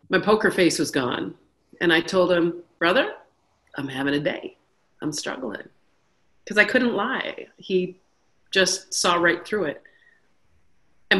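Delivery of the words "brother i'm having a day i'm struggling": tired admission. Retold or imitated. She admits struggling, poker face gone.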